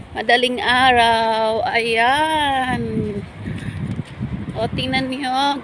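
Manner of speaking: ordinary delivery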